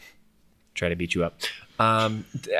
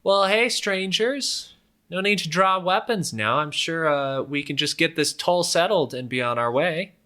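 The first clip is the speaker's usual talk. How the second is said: higher-pitched roadside bandit hailing strangers; toll collector calling out; 8 semitones higher, 3 dB louder than usual. Acted. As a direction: hail the travelers like a crooked toll-taker. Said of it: "the one with the sword yells out".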